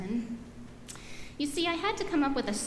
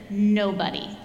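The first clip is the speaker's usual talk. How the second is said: sulky refusal; 7 dB louder, slower than usual. Acted. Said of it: "And I said".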